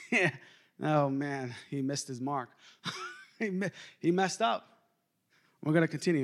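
ordinary delivery

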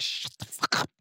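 airless, soft whispered snap; whispered hush. Pretend. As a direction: hiss it so no one hears. Lawyer hushes the voice.

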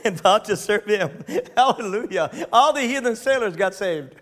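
real laughter breaking into the reading; laughing. Real, not acted. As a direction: laugh through the words with delight. Delighted preacher laughing at the verse.

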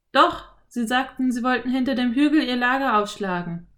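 put-on a clever boy explaining; boy Philipp. A narrator's reading. Philipp voiced. (Jungenstimme, erklärend)

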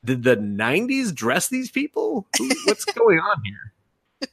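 high, laughing incredulity; amused disbelief. Retold or imitated. Viewer mocks the baggy clothes, laughing and incredulous. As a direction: question it in mock bewilderment, voice climbing.

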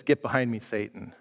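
low, soft, grave rebuke; stern words dropping low and hushed; unsmiling rebuke. Retold or imitated. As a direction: rebuke him quietly, without a trace of a smile. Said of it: "and now he's not smiling".

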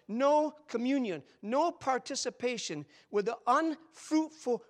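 loud, high, slow pulpit cry; full-voiced revival preaching; preaching at full force. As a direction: build to a thundering challenge. Climactic pulpit declaration.

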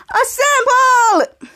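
high, long-stretched "assemble"; acted rallying cry. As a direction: draw out a superhero battle call. Retold hero rallies team.

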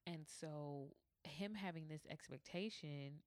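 usual speaking voice

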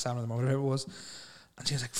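usual speaking voice

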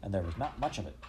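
usual speaking voice

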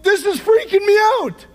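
high, loud mock fright; hammed-up panicked disciple; a light comic freak-out; mock scared John. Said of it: "John is like".

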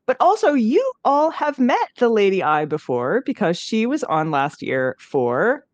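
ordinary talk, nothing marked

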